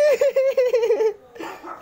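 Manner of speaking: long, high-pitched laughter; real squealing laugh. Real, not acted. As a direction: burst out laughing high and hard. Bursts out at the friend.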